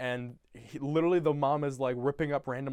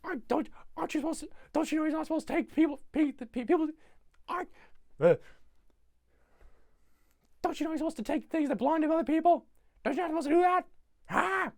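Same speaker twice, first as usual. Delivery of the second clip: high-pitched, rushed scolding imitation; character imitation; 12 semitones higher, faster than usual. Trying to recall his line, high pestering mock voice. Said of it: "He goes".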